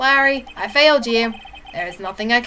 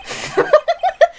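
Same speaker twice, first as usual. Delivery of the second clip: a sudden very high cackle; the witness breaking into a high-pitched cackle. Acted. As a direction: burst into a squeaky high laugh. Witness reacts on the stand.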